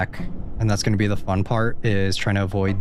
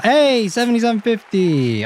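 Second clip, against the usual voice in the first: pleased surprise; 12 semitones higher, 5 dB louder than usual. Real, not acted. Seeing the payout.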